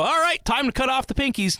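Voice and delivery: high-pitched mock cult leader call; cult announcer. Cult tells new members it is time, mock announcing.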